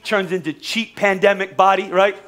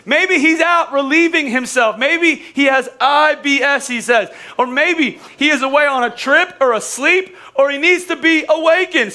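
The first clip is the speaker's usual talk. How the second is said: mocking Elijah; 7 semitones higher, 4 dB louder than usual. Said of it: "Elijah says it".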